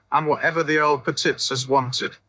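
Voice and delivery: usual speaking voice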